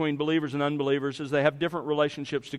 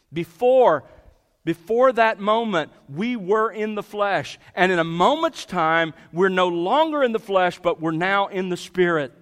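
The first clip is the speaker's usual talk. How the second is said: raised, louder preaching that builds its point; impassioned preaching; 4 dB louder than usual. Acted. Preacher urging the congregation about their change, fervent. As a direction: drive it home from the pulpit.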